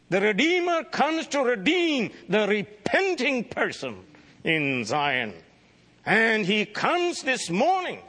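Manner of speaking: high, ringing preacher's voice, lifting on each phrase; fervent preaching. Acted. Preacher urging his congregation toward repentance, ringing and fervent. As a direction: preach it out to the congregation with rising fervour.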